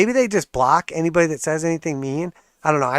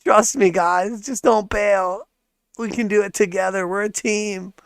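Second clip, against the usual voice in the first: mocking pleading Kathy; 4 semitones higher than usual. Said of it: "She's like".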